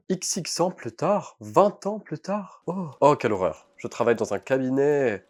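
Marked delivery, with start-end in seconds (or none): 0.1-2.6 dramatic narrator. Acted. Titre d'intro lu avec emphase.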